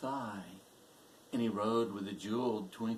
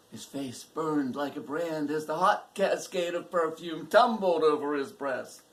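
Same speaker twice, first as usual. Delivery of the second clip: higher, louder surge of passion; impassioned narration; 5 semitones higher, 9 dB louder than usual. A narrator's reading. Narrator on the hair tumbling over him, impassioned. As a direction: build hard into the tumbling climax.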